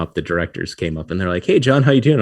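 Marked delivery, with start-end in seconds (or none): 1.4-2.2 cheerful greeting. Retold or imitated. Directors greet him cheerfully.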